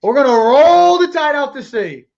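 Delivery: loud, high rallying cry; hyped-up boast. Real, not acted. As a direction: stretch "roll" out loud and long. Hyped-up boast about beating Alabama.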